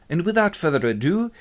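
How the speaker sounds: ordinary delivery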